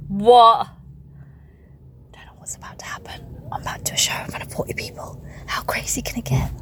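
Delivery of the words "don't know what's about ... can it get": hushed stage whisper. Acted. Teasing podcast listeners about the show, a hushed whisper. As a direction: whisper it like a secret.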